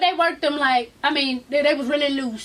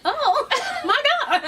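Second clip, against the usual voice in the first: high, laughing swoon; giggly high-pitched exclamation; flustered reaction; 9 semitones higher than usual. Retold or imitated. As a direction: act out being overcome, pitched way up. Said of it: "I was like".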